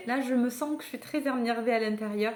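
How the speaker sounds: normal talking voice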